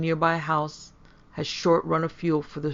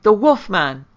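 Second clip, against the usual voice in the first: high, very loud alarm cry; screamed; 5 semitones higher, 11 dB louder, slower than usual. A narrator's reading. Jacques warns the party of the wolf, screaming. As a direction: yell the warning at full voice. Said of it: "Jekyll screamed".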